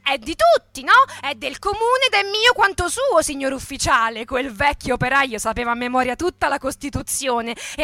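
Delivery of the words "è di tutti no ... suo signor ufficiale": louder, higher retort to the officer; defiant reply. Acted. Old worker answering the captain defiantly. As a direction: stand your ground politely.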